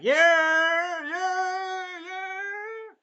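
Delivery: high, loud mock cheer of the crowd. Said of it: "And everyone is like".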